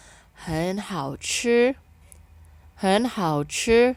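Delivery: very slow, clear Mandarin, syllables spaced; slow model reading. Shown as a demonstration. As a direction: model it for learners to repeat. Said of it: "Kita baca ya".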